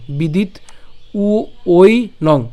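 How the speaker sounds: slow, drawn-out split demonstration; বিদিত, then উ, ওই, নং each held apart; broken into syllables. Shown as a demonstration. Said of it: "কারণ এখানে অন্তস্থ ব রয়েছে".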